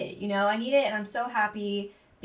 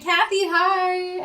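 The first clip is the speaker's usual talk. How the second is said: high, loud, drawn-out hello; excited greeting; 9 semitones higher, 7 dB louder, much slower than usual. Real, not acted. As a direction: call out gleefully to a best friend. Calling out to absent friend.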